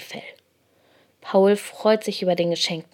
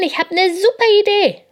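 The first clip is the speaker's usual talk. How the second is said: high, loud burst of enthusiasm; excited announcement; 13 semitones higher, 6 dB louder than usual. A narrator's reading. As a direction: burst out with a bright idea. Hare has a sudden idea.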